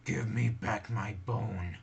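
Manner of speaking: growing anger, drawn out and loud; angry ghost. Acted. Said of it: "It was beginning to sound angry".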